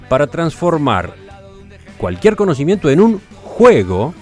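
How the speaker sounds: scripted presenter voice with emphatic key words; radio intro read. Acted. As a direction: announce the topic with deliberate pauses and lively pitch swings. (locución de presentación radiofónica)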